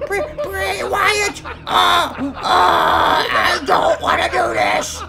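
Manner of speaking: high, slow, drawn-out whimpering plea; dread. Acted. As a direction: drag it out in hammed-up terror. Ferb dreads the started match.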